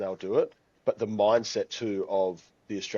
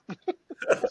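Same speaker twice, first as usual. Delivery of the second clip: breathy laugh. Real, not acted. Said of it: "Oh my gosh".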